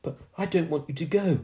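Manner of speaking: put-on high, imploring girl's voice; pleading. A narrator's reading. Girl begging the ghost to stay, pleading. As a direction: beg him to stay. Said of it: "she pleaded".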